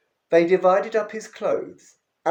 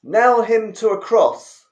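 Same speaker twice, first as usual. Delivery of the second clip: loud, higher crowd yell; 6 semitones higher, 8 dB louder than usual. Acted. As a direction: shout it as the angry mob. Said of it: "They yelled".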